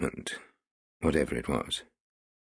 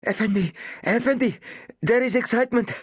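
put-on high, breathless boy's voice, pitched way up; panting small boy; 4 dB louder than usual. A narrator's reading. Small boy panting to the policeman Owen, high and breathless. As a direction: gasp it out like an eager urchin. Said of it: "panted the small boy".

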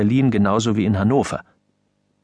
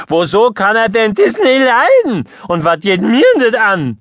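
high, loud squeaky voice cracking down into bass; a boy's breaking voice jumping between squeak and growl; mice in a tin can with bass; 11 semitones higher, 7 dB louder than usual. A narrator's reading. Son cheekily questioning his father in a squeaky breaking voice. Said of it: "wie wenn jemand Mäuse in eine Blechbüchse gesperrt hätte und dazu Bass spielte".

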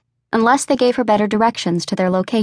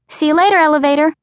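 fast, higher singsong farewell; playful rhyme; 7 semitones higher, 3 dB louder, faster than usual. A narrator's reading. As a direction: toss off the rhyme quickly and playfully. Childhood rhyming game goodbye.

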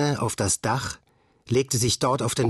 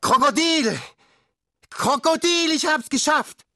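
much higher, louder cry of victory; triumphant shout; 3 dB louder than usual. A narrator's reading. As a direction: yell it from the rooftop. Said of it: "hob beide Arme und rief".

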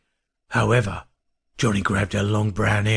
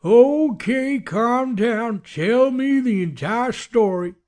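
very high put-on sheriff's voice; sheriff taking charge; 14 semitones higher, 3 dB louder, much slower than usual. A narrator's reading. Sheriff calms the hysterical girl.

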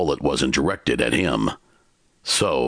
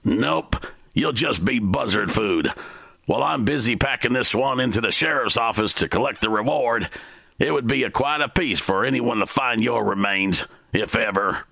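gunman's threat; 8 semitones higher than usual. A narrator's reading.

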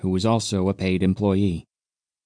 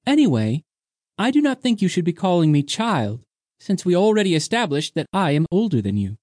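put-on higher, playful young woman; higher voiced young woman teasing the captain; 8 semitones higher than usual. A narrator's reading. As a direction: tease him lightly. Undina, smile returned.

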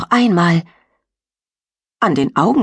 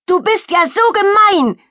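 much higher, whining cry; whiny shout; 4 dB louder than usual. Acted. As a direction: yell it close to tears. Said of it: "schreit sie Jan mit weinerlicher Stimme an".

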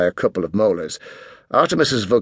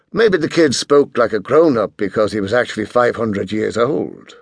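usual speaking voice

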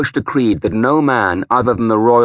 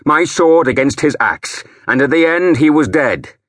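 loud and higher, voice climbing into a shout; rising to a shout; 7 semitones higher, 5 dB louder than usual. A narrator's reading. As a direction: build from loud to a full shout. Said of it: "My voice had risen until I was shouting".

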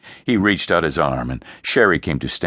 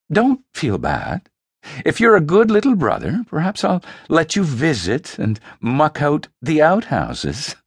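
a touch of higher, quick mock sweetness; smirking taunt; 10 semitones higher, 3 dB louder than usual. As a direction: tease him with a smirk. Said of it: "she smirked".

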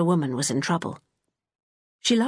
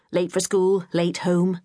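ordinary delivery

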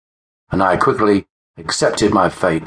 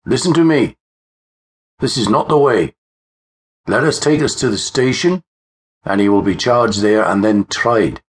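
high, urgent appeal to a mob; constable's raised-voice appeal; calming a crowd; 4 semitones higher than usual. A narrator's reading. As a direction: plead for order, pitched up, easing down at the end. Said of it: "tried to calm the crowd down".

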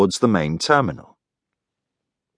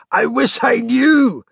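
slow, high, strained hoarseness; hoarse voice; slower than usual. Acted. Greg answers with smoky drink in his throat, hoarse. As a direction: croak it out after strong drink. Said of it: "Greg said in a hoarse voice".

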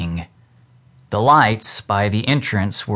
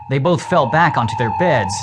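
usual speaking voice

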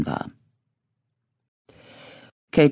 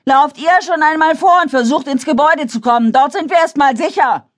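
high, loud orders; commanding shout; 14 semitones higher, 4 dB louder than usual. A narrator's reading. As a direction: urge them on over the rain. Katie orders the group.

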